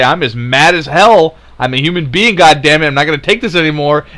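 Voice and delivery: loud, furious declaration shouted at the world; angry shout. Acted. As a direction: yell it like you've finally snapped. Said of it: "if we don't get up right now and say".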